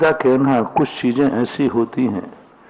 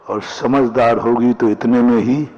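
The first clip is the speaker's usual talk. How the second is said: ordinary delivery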